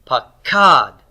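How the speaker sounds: "Pakad" with long, strong KAD; stress on root two. Shown as a demonstration. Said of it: "think about where my stress is".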